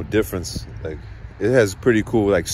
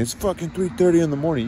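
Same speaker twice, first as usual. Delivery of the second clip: mock nagging mom, pitched higher; 9 semitones higher than usual. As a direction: whine it like his mom scolding him. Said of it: "the nerve of my mom saying".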